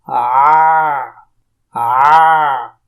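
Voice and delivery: آ pushed hard from the mid throat, mouth open; pressed throaty aa. Shown as a demonstration. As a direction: strain the throat on a long آ. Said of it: "حلق میں دباؤ ڈالنا پڑتا ہے".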